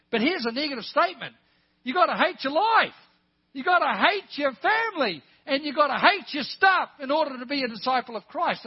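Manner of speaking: loud, high, insistent pulpit delivery; full-force preaching. Real, not acted. Preacher challenging congregation to love Jesus above stuff, full-force preaching. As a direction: shout it out to the whole congregation.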